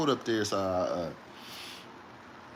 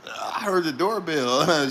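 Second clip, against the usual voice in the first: mimicking her; 3 semitones higher, much slower than usual. Mimicking partner's claim.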